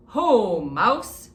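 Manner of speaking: loud cheerful hare; hearty hare greeting. Acted. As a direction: greet an old friend loudly.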